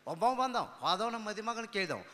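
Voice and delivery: usual speaking voice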